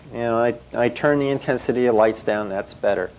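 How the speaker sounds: ordinary talk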